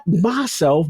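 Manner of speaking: ordinary talk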